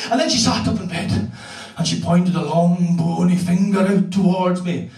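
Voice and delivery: ordinary talk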